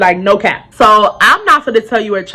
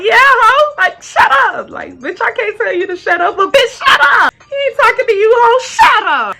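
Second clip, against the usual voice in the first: high, squealed jeering; exaggerated high-pitched telling-off; gleeful put-down; 13 semitones higher than usual. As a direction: jeer along in a high voice. Gleefully echoing the man's put-down.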